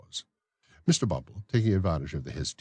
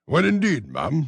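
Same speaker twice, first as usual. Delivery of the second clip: raised, fussy official's voice; pompous beadle; 10 semitones higher, 7 dB louder, slower than usual. Acted. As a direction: play the self-important beadle. Bumble rejoins.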